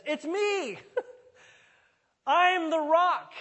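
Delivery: high, loud, drawn-out cry; exultant shout. Acted. Preacher as Jesus interrupting the ceremony, high triumphant shout.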